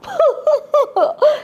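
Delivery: high mocking scoff of a laugh; snooty laugh. Shown as a demonstration. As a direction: laugh off the idea of a wolf. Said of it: "act a little snooty".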